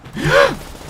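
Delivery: startled high gasp; sharp gasp. Real, not acted. Startled by zombies arriving early, real gasp. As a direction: gasp as the zombies rush you.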